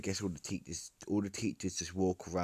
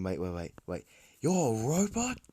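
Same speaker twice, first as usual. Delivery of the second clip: rising to a loud, high shocked question; crowd shouting; 5 semitones higher, 5 dB louder than usual. Acted. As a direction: shout the disbelief, louder at the end. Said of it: "they all shout".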